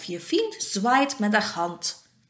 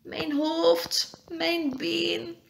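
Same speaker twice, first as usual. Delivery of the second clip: high, drawn-out little moans; acted high, pained whimper; groaning in pain; 8 semitones higher, much slower than usual. A narrator's reading. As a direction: moan over each sore spot. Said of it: "kreunt hij".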